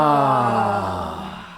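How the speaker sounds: low sustained ah; low sighing tone. Shown as a demonstration. Teacher leads the group sigh on a chosen note, low ah. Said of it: "one, two, three".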